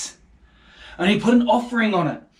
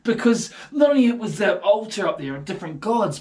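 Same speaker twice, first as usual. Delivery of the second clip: ordinary talk, nothing marked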